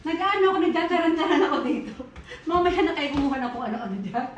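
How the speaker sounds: giggling through the words; bubbling laughter while she talks; laughing. Real, not acted. Laughing at her own antics. (tumatawa habang nagsasalita)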